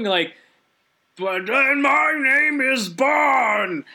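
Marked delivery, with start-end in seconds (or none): 1.2-3.8 slow, high mock villain shout; villain yell. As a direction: bellow the name slowly like a cartoon villain. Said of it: "like, yelling".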